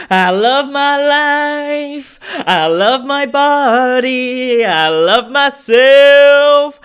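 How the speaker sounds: high, loud, slow stadium shout; shouted affirmation. Retold or imitated. Imitating a celebrity shouting affirmations to Stockholm. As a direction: yell it to a whole crowd. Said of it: "get Bianca Ingrosso to shout".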